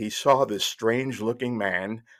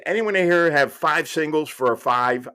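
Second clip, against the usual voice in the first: louder, higher call to everyone within earshot; pretty loud call; 5 semitones higher, 5 dB louder than usual. Retold or imitated. Cab passenger asking bystanders for change, calling out loudly. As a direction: call it out for all to hear. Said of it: "pretty loudly".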